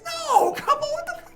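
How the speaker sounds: high, loud, laughing retort; exasperated protest. Retold or imitated. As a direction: protest loudly in disbelief. Retells protesting at friends' mistake.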